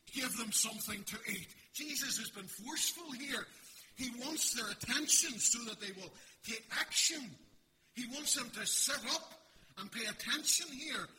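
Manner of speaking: loud, high, driving sermon voice; booming pulpit delivery; full-force preaching. Acted. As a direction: preach it hard to the back row.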